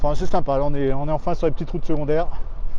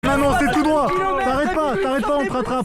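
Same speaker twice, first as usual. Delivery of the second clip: shouting over wind; 11 semitones higher than usual. Real, not acted. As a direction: yell ahead to the group. Calling to riders ahead in wind.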